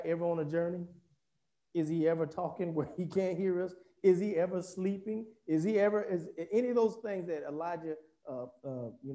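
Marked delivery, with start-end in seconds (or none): none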